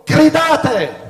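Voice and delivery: full-voiced shout of Gridate; shouted command. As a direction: bellow it like Joshua ordering the people. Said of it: "Giosuè disse al popolo".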